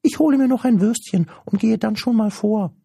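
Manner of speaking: very high, loud, quick squeaky creature voice; high-pitched, eager creature chatter; cheeky little creature. Acted. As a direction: pipe up like an impish little creature.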